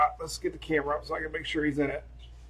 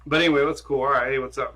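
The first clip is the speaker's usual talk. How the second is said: ordinary talk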